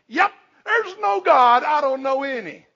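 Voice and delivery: lightly voiced God, amused and high; a hint of a jovial God; playful God voice. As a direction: toss it off as God chuckling at the idea. Preacher voices God's answer.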